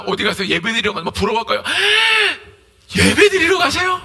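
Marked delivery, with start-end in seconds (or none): 1.6-3.9 high, loud gasp, then the question; mock amazement. Outsider marvelling at someone off to worship, mock amazed. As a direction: exclaim it in exaggerated awe.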